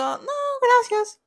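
very high, drawn-out dainty voice; retold polite refusal. She retells declining his milkshake, high polite voice. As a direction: pitch it way up and stretch it slowly.

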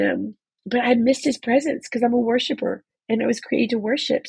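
ordinary talk

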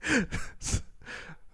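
high, wheezy laugh; real laugh at your own story. Real, not acted.

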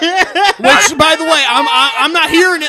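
loud mock indignation; mock outrage. Mock outrage about non-binary.

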